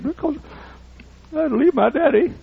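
put-on boy on plane tells preacher why he cries, high child voice; crying boy's voice. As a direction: speak as a tearful little boy.

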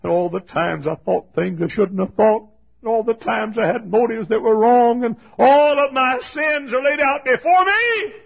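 mounting pulpit intensity; building preaching. Real, not acted.

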